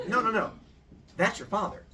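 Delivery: no no no, point at the sky; eager correction. Acted. Mom correcting her confused son, eagerly.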